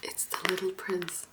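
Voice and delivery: gentle ASMR soft-spoken voice with long pauses; soft talking. Acted. ASMR host introducing favorite books to viewers, soft talking. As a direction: talk softly and unhurriedly to the listener.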